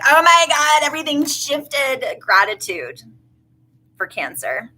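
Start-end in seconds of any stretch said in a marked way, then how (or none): 0.0-2.0 high, loud mock astonishment; imitated amazed viewer. Burning Man viewer watching the reading later, high mock amazement. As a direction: gush like an amazed festival-goer. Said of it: "watch this later and be like".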